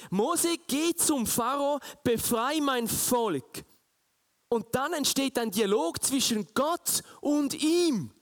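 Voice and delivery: preaching at full force. Acted. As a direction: proclaim it like a fervent preacher. Preaching God's words. (laute, feierliche Predigtstimme)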